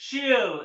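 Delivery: SHE'LL as one syllable; stylish contraction. Shown as a demonstration. Teacher models the stylish form of she will, contracted SHE'LL. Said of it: "style से बोलते हैं".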